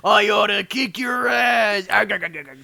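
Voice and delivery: loud, slow cartoon crab shout; Mister Krabs yelling. Krabs yelling at Squidward, loud slow cartoon shout. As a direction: roar like an angry cartoon crab.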